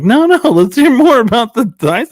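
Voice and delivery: loud, high, laughing brush-off; mock eager coworkers. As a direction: cut him off eagerly, half laughing.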